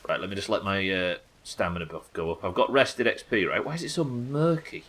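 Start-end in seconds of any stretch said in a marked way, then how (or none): 3.6-4.8 raised, oddly lilting "Why is it so murky?"; a quirky, higher-pitched wondering question; odd delivery. Real, not acted. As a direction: lift the question higher in a strange tune. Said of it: "I don't know why I said it like that".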